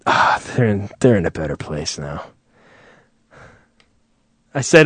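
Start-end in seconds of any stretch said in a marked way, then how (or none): 0.1-2.3 low, soft mock-solemn platitude; stock condolence. Mourner shaking the bereaved's hand, low rote comfort. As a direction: sigh it out low and consoling. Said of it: "you hear, like, six lines".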